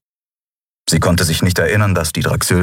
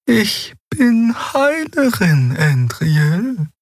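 gently; much slower than usual. Acted. Xea gently explaining her duty to Endriel, tender and slow. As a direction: say it tenderly, like a kind elder. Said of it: "sagte sie sanft".

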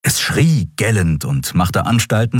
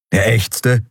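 usual speaking voice

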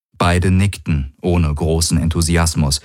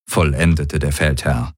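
ordinary delivery, nothing marked

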